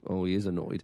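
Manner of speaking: low mock voice. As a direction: drop the voice low and flat. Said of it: "then he goes".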